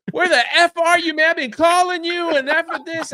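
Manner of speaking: loud, high angry yelling; angry friend. Retold or imitated. Friend on the phone cussing him out, loud angry yell. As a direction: blow up at him like the furious friend. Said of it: "Like, he's mad".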